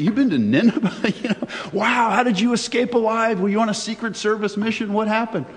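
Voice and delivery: high, wide-eyed disbelief; amazed townsfolk. Acted. Townsfolk gaping at Jonah back from Nineveh, high and amazed. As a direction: gush in awe at a hero's return. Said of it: "eyes would get real big".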